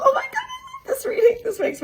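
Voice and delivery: very high, laughing squeal of joy; delighted squeal. Real, not acted. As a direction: squeal it out, thrilled and giggling. Delighted by tarot reading result.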